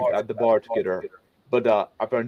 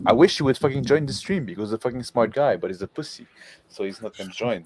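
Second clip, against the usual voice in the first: ordinary delivery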